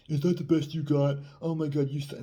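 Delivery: high, loud mock scorn; acting the asshole. Shown as a demonstration. Dating coach showing how not to tease, mock-obnoxious. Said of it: "not being an asshole and be like".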